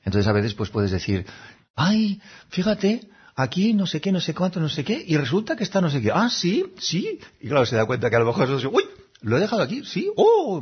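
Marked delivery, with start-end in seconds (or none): 1.7-6.1 teasing sing-song reminder, much higher; joking correction. Pretend. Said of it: "con una broma divertida". 6.1-7.2 high, sing-song agreement; high playful yes; amused reply. Pretend. Other person's amused reply. 8.7-10.6 very high, quick, hammed-up gasp; exaggerated oops, voice way up; mock surprise. Mock surprised reaction to mistake.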